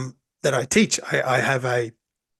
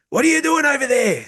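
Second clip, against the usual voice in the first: high, loud shouted challenge; sensei bark; 11 semitones higher, 5 dB louder than usual. Retold or imitated. Old sensei shaming late students, shouting. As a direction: bark it at the latecomer.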